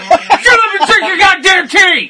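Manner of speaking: laughing while yelling a demand; mock angry shout. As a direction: yell it, cracking up halfway. Acted furious tea demand.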